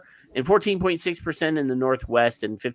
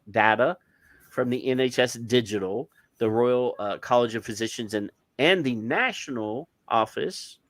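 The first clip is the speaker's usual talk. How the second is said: ordinary delivery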